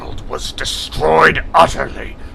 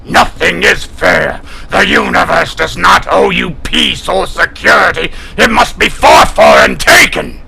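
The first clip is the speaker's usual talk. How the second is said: rising to a roaring, higher shout; furious scolding; 5 semitones higher, 6 dB louder than usual. Acted. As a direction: berate him, louder with every sentence. Kilrathi scolds the whining human.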